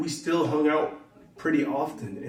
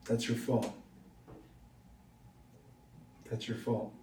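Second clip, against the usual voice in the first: quiet muttered blame; 4 semitones lower, 12 dB quieter than usual. Real, not acted. Blaming friend for dead dog.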